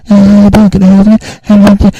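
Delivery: loud, high, in-your-face greeting; right on the mic. Retold or imitated. Store owner right in the customer's face, loud and pushy. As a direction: crowd the mic with an overbearing hello. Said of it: "why was I that close to the mic?".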